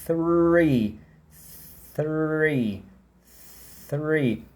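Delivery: "Three" overarticulated, with a long blown TH; exaggerated "three". Shown as a demonstration. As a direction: stretch "Three" for students. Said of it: "eu tô falando exagerado por motivos didáticos".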